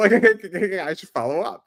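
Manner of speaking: laughing through the words, slowed down. Real, not acted. Telling his friend about trolling the hacker, laughing. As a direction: giggle it out, amused at himself.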